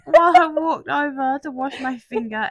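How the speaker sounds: high, loud laughing speech; giggling through the story; laughing while talking. Real, not acted. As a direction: talk through helpless laughter.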